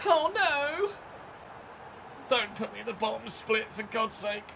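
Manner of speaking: high-pitched plaintive wail; high, despairing plea; pleading dismay. Real, not acted. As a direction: wail it high, begging to be spared. Dismay at league split threat.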